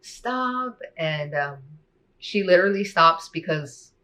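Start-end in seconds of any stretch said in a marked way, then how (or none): 0.0-0.8 sobbing plea. Retold or imitated. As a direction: beg her to stop through tears, retold. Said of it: "I'm just sobbing and screaming".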